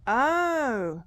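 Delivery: startled yelp; sudden jump scare yelp; jump scare laugh. Real, not acted. Jump scare in horror game.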